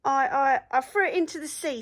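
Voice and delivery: fast, nervous stutter; frightened stammer. A narrator's reading. Troll caught by four angry pirates, scared stammer. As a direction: stammer it out in a fluster.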